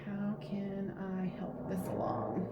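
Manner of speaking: slow whisper to herself. Real, not acted. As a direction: whisper it slowly while thinking. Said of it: "need to catch my breath".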